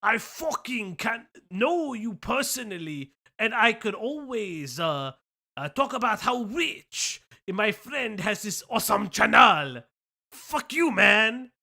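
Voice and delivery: loud, indignant mock French; French accent. Reads insults from a post in a loud French accent. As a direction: rant in a hammed-up French accent. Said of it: "my French accent".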